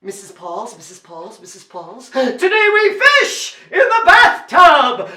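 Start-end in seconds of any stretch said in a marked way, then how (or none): 2.1-5.1 high, loud announcement; king proclaiming. Acted. King to court from the bathtub, loud proclamation. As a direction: declare it with royal gusto.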